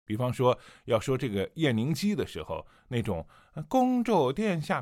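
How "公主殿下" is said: much higher, slow and coy; coquettish enchantress. Shown as a demonstration. Teacher demonstrating Yan Lingji's alluring voice, high and slow. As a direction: coo it like a bewitching sorceress. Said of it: "比较妖里妖气的那种感觉".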